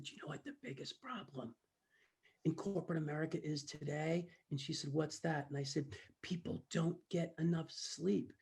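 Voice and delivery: softly confiding, voice well down; hushed secret. Retold or imitated. Speaker confides to a curious mom in a hush. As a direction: lean in and share it like a secret. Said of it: "Come closer".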